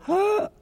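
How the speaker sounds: sharp high "Ah" of sudden insight; high gasp of realization. Retold or imitated. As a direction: gasp as if the curtain just opened. Sudden realization after stopping. (suspiro agudo de revelación)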